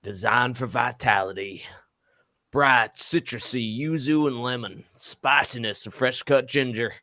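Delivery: cowboy voice. Acted. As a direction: read the label like a cowboy. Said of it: "I'm gonna do the cowboy one".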